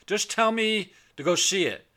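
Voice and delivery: high, loud pleading protest; retold protest. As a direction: cut the friend off, loud and high. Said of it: "I go".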